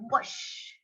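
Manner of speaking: long airy whoosh; whispered whoosh. Shown as a demonstration. Storyteller teaching children the story's sound effect, whooshing. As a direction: let out a voiceless, rushing whoosh. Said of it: "we're going to say".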